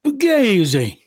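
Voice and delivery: mock Italian accent, high and punchy. Cohost delivers the verdict for the segment in a mock Italian accent. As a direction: bark it like a mobster in a Italian accent. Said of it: "He says it with that great Waco Italian accent".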